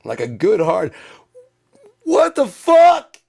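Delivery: high, drawn-out full scream; slow, piercing cry of disbelief; screamed outrage. Shown as a demonstration. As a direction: scream it good and hard. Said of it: "If you haven't screamed".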